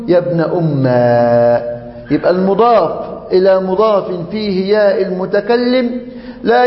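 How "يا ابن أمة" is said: يا ابن أمة said slowly, ending on a clear open a in أمة; slow demo pronunciation. Shown as a demonstration. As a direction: model يا ابن أمة slowly with a long final a. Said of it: "فتقول". (نطق يا ابن أمة ببطء مع فتح آخرها)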